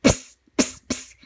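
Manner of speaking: unvoiced psst through a wall; whispered psst. Cat whispering to the pups through the wall. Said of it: "He whispered through a hole".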